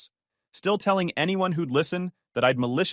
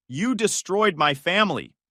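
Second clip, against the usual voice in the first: shouting; 7 semitones higher than usual. Acted. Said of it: "he was shouting now".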